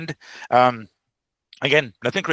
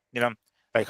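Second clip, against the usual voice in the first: usual speaking voice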